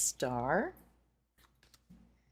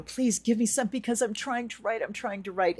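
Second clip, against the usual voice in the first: higher, pleading writer's voice; pleading blocked writer; 7 semitones higher than usual. Pretend. Reader voicing a stuck writer begging for words, high plea. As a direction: beg like a writer who is stuck on a blank page.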